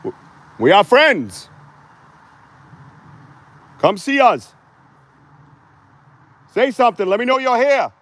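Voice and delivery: loud high call into the woods. Real, not acted. Bigfoot hunter calls loudly into the forest.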